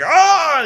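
a high laughing mock yell; hammed-up roar with a laugh; mock giant roar. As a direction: yell it out like the giant fighting back. Show roaring as he makes comeback.

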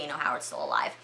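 plain reading voice

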